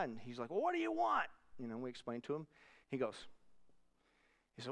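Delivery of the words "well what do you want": imitated worked-up demand. Worked-up man to visitors on his doorstep, imitated irritated demand. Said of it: "He's all worked up".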